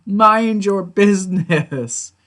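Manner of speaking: loud, high exasperated outburst, drawing out the words. Retold or imitated. Fan fed up with Dale, exasperated. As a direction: snap at a busybody, high and loud.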